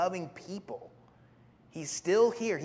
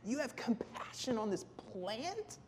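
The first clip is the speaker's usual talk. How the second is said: high, softer, incredulous question; 6 semitones higher, 8 dB quieter than usual. Acted. God questioning Jonah's compassion for a plant, incredulous. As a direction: ask as if it's absurd.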